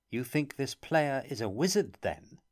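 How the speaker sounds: lightly mocking question, voice lifting; a touch of scorn in the question; teasing him mildly. A narrator's reading.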